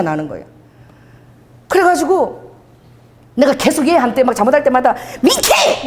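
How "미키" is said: high-pitched, exasperated call of the name; angry scolding. Retold or imitated. Owner scolding the cat for misbehaving, angry. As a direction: re-enact yelling at a naughty pet. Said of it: "화를 막 내서".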